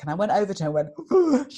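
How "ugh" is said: reenacted gasp of overwhelm; anxious gasp. Storyteller showing another woman her nerves, anxious gasp.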